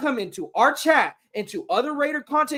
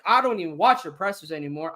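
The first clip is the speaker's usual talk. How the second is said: ordinary delivery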